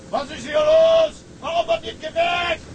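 captain roaring at his lazy crew, furious; angry bellow. Acted. Captain storms in, yelling at crew.

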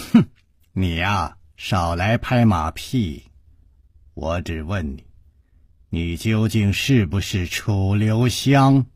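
low, cold, measured voice; icy and unamused, kept low; Hua Jingong, coldly. A narrator's reading. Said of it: "花金公冷冷地笑".

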